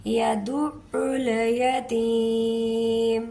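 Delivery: यतीम held for three to four counts, very slow; elongated ending. Shown as a demonstration. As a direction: stretch the last vowel of यतीम. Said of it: "तीन से चार account finger".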